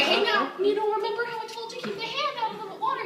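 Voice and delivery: high-pitched, hurried kiddie-ride reminder; patient reminder. Retold or imitated. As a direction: remind a child nicely in a high voice. Acted patient reminder to the kid.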